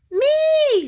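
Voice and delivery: put-on high, loud little-girl "Me"; little sister begging to join in, high and loud; eager little Nika. Younger sister pleading to her big brother, high loud voice.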